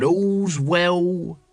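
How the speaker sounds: slow, drawn-out watchman's call; mumbling the hour like a bored night watchman; muttered town crier call. A narrator's reading. Nobby to Carrot, muttering the watch call slowly. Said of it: "he muttered".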